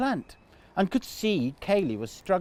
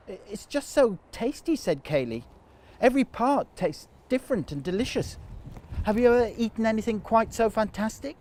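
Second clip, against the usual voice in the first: a lightly higher, eager goat voice; lightly voiced excited little goat; eager young goat Kaylee; 6 semitones higher than usual. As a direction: gush over the tasty plant.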